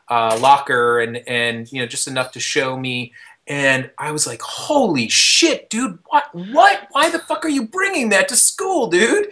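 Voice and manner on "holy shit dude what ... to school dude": high-pitched, incredulous outburst; retold shock. Storyteller confronting the kid over a gun at school, incredulous. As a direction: start drawn out, then jump high in disbelief.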